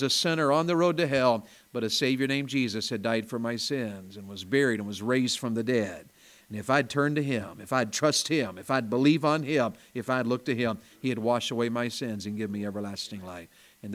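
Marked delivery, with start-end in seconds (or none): none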